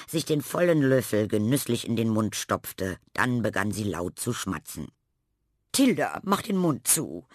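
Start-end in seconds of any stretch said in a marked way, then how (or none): 5.7-7.3 scolding. Acted. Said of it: "tadelte Frau Lerche".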